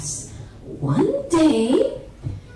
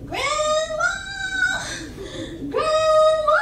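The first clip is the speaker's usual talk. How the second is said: high, drawn-out acted scream for Grandma; top-of-voice scream; 14 semitones higher, slower than usual. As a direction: scream for help at a very high pitch. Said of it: "on the top of her voice".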